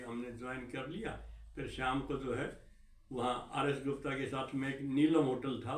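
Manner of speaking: usual speaking voice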